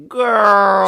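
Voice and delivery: put-on long, loud, high "girl" of disbelief; mock disbelieving. Mock group reaction to Elena's theory.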